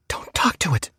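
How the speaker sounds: sharp whispered warning; urgent whisper. Acted. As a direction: hiss the warning under breath. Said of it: "urgent warning".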